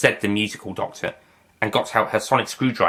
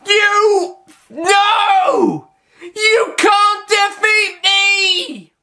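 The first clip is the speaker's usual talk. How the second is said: howling denial; 5 dB louder, much slower than usual. Acted. Master defeated, singsong denial.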